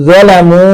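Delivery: জলামু with a heavy, rounded জ; জলামু, জ pronounced heavy; heavy letter. Shown as a demonstration. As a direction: read জলামু with a thick first sound. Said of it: "দেখেন এখানে কিন্তু".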